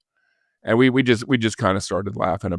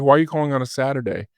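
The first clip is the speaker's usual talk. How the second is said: quick puzzled question; 6 semitones higher, much faster than usual. Retold or imitated. Tina puzzled by weekend call.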